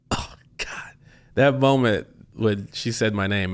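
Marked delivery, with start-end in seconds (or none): 0.1-0.9 breathless unvoiced "Oh, God"; awed whisper. Accepting the award, whispering in shock. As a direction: whisper it, stunned by the moment. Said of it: "I'm like".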